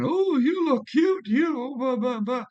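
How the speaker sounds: high, slow, sleazy sing-song come-on; over-sweet mock pickup line, drawn out high; mocking flirty voice. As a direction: mimic a creep catcalling women on the street. Mimics friend flirting with women.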